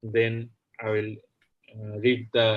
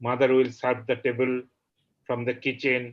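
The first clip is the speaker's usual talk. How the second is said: declaimed openings, settling into measured verse; poetry recitation; 2 semitones higher, 4 dB louder, faster than usual. Acted. Poet reading the translated version to the audience, poetry recitation. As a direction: recite the verse line by line with deliberate pauses.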